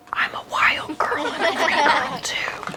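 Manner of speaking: soft confiding whisper; whispered aside. Real, not acted. Pastor confides to the kids in a whisper.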